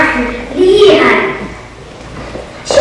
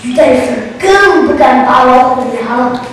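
plain reading voice

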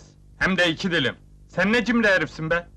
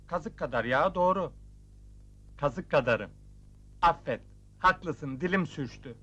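subdued, softer agreement; meek apology; 3 semitones lower, 6 dB quieter than usual. Acted. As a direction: give in and apologise sheepishly. Meekly accepting the scolding, asking forgiveness. (süklüm püklüm, alçak sesle)

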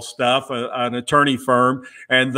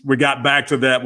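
usual speaking voice

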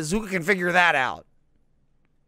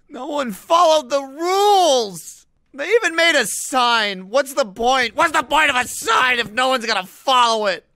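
ranting tearfully about the ignored sign; hammed-up tearful rant; 6 semitones higher, 6 dB louder than usual. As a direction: wail it like a betrayed mourner. Mock despair over broken rules.

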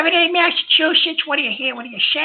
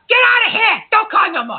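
angry shout; 5 semitones higher than usual. Real, not acted. Host furious at caller, shouting him out.

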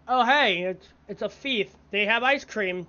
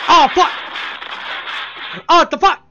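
startled curse; 7 semitones higher, 10 dB louder than usual. Real, not acted. Sudden game failure.

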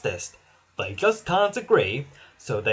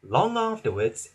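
plain reading voice

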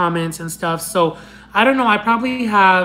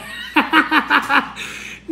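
high giggle; 7 semitones higher than usual. Real, not acted. Laughing at dinosaur nickname.

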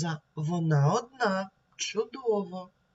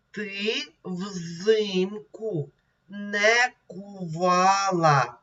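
slow, syllable by syllable reading; 2 semitones higher, much slower than usual. Shown as a demonstration. Teacher rereading the poem slowly for pupils to repeat. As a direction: read it slowly so children follow. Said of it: "І ще раз давайте прочитаємо".

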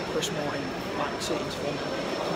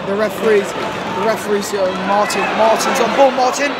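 loud, urgent match commentary; live play-by-play; 5 semitones higher, 11 dB louder than usual. Real, not acted. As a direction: call the action as it happens.

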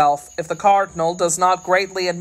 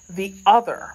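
ordinary delivery

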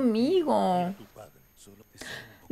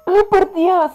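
high, stretched-out exclamation; stunned disbelief; 7 semitones higher, 3 dB louder than usual. Real, not acted. Viewer disbelieving the scene, high stunned exclamation. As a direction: exclaim in real shock. Disbelief at what happens on screen.

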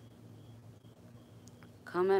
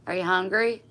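louder, higher repeat; 5 semitones higher, 11 dB louder than usual. Real, not acted. Owner asking her dog again, louder and higher.

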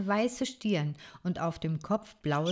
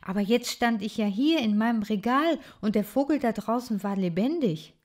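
usual speaking voice

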